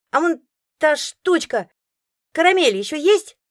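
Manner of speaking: put-on high, loud hopeful boy; hopeful child. A narrator's reading. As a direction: ask for caramel hoping. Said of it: "с надеждой".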